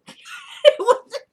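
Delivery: a sudden high, loud burst of laughter. Real, not acted. Vlogger cracking up at her own joke, loud laugh.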